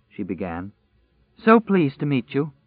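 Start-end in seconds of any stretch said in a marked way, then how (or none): 1.4-2.5 overwhelmed. Acted. Overwhelmed woman meets survivors.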